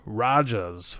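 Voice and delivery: ordinary talk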